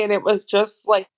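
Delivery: normal talking voice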